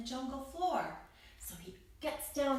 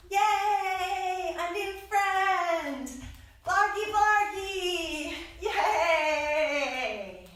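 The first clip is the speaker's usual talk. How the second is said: much higher and louder, laughing and slowed; joyful cheering; 7 semitones higher, 8 dB louder, much slower than usual. Acted. The friends celebrate their new friend with loud cheers.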